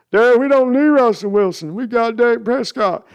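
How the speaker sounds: a grown son talking his father down; imitating Jerry's son. Son argues with father Jerry about trades, high mock plea. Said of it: "Jerry and Sons impression".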